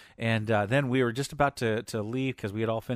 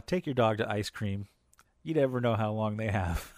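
normal talking voice

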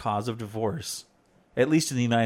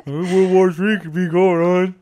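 slow, loud, higher mock voice; exaggerated mocking voice; 5 semitones higher, 7 dB louder, slower than usual. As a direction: mimic the mimicry, dragged out and loud. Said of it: "You mock my voice".

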